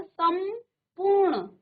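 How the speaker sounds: संपूर्ण split slowly, with a held m; teacher sounding out सम-पूर्ण; pronunciation demonstration. Shown as a demonstration. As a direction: hum the m in सम, then पूर्ण. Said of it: "आप उच्चारण में देखिए".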